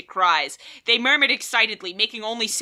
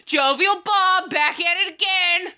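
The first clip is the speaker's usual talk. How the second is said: meme voice; 8 semitones higher than usual. Acted. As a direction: hype it up like a meme. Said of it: "Wrong meme".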